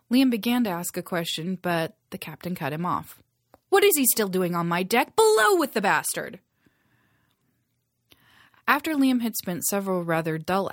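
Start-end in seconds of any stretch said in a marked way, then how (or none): 3.7-6.4 angry order. Acted. Captain orders prisoner below.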